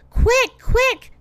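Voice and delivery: high, short bird-like twitter; urgent high chirps. A narrator's reading. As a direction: twitter it high, like little birds. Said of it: "twittered two flying birds".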